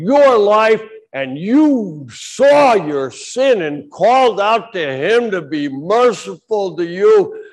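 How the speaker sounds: loud, slow, swelling sermon delivery; full-force preaching. Acted. Pastor urging the congregation, loud and slow preaching.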